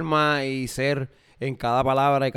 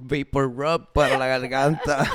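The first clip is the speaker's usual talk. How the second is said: usual speaking voice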